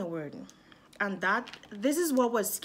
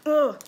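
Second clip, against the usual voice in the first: frustrated groan; 7 semitones higher, 11 dB louder than usual. Real, not acted. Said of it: "I was kinda upset at myself".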